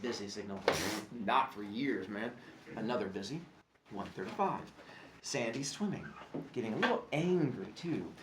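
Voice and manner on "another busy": ordinary delivery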